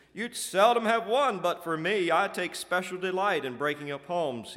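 loud, high villain's boast; gleeful gloating. A narrator's reading. Old Alcohol bragging to the demons, gleeful. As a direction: gloat with wicked glee. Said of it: "he chuckled with hideous glee".

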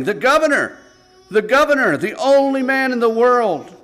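loud, high lament breaking into sobs; condemned lad grieving his missed chance, sobbing loudly; anguished wailing. Acted. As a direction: wail it out, realizing it's too late.

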